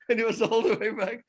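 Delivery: amused laugh while telling the story. Real, not acted. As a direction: let the laugh spill over the line. Laughing at the fleeing driver.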